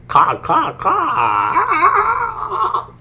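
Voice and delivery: cock, cock rising into a high laughing rooster crow; rooster crow imitation. Trader clowning on stream as a crowing rooster, laughing. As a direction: crow it like a rooster, cracking up at the end.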